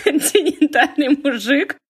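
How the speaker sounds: amused, laughing disbelief; laughing echo. Real, not acted. Host laughing back at the guest's funny phrase, amused question. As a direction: laugh through the question, slowly.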